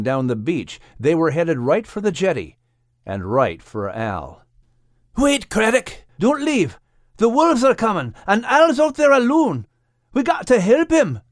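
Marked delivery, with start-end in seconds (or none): 5.2-11.2 high-pitched, alarmed character cry; urgent yell. Acted. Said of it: "yelled Max".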